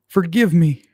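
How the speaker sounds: high, loud theatrical begging; dramatic plea. Pretend. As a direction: beg for mercy, hammed-up. Hammy plea for forgiveness.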